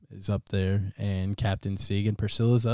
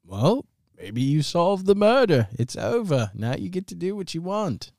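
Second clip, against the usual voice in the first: game character's breezy verdict; 9 semitones higher, 3 dB louder than usual. Acted. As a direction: pitch it up and stretch the words.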